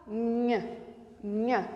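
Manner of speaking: "Nha" as one isolated palatal nasal sound, softly; sound demo. Shown as a demonstration. Teacher showing the sound of N H, isolated "nha". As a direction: say "nha" softly alone, pause, repeat. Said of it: "é o caso do N e o H".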